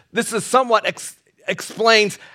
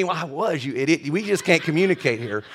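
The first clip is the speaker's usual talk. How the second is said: dog talking back; 4 semitones lower, 6 dB quieter than usual. Retold or imitated. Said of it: "He looked up at me".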